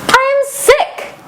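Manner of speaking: high-pitched burst of glad realisation; thrilled storybook outburst; excited exclamation. Acted. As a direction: exclaim it high, as wonderful news. Character delighted to be sick.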